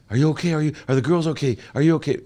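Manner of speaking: high, fast worried mother's voice, imitated; worried mom. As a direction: mimic mom anxiously asking if he's okay. Mom answers son's bad news.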